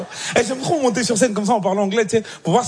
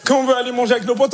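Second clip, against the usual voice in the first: mock indignation; 6 semitones higher than usual. Mock-indignant comparison on stage.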